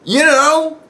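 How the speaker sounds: high, loud squeak of surprise; flustered. Retold or imitated. Grandson answering grandma, high flustered squeak. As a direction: caught off guard, squeaking. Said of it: "And I was like".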